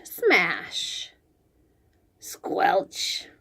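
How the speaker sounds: ordinary delivery, nothing marked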